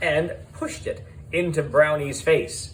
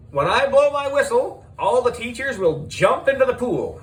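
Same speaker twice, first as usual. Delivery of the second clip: host announcing; 9 semitones higher than usual. Acted. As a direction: announce the rules with flair.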